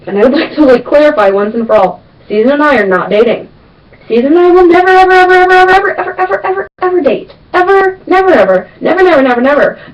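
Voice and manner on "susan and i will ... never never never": emphatic never ever. Acted. To viewers, insisting she and her best friend will never date, high and emphatic. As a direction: hammer home that it will never happen.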